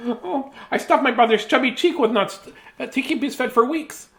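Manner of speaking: high squirrel confessing he hoarded nuts. Acted.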